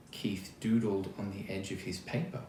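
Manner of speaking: ordinary delivery, nothing marked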